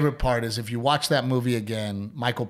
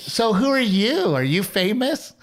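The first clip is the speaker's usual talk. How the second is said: higher, slow, wide-eyed asking; higher curious woman voice; 9 semitones higher, 4 dB louder, slower than usual. Retold or imitated. Telling how a woman quizzed the singer, higher and slow. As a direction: play the curious fan, pitched up. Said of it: "she goes".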